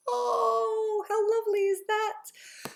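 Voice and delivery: high, soft melting reaction; as if your heart just melted, soft and high; high, hushed, deeply touched; touched and moved. Retold or imitated.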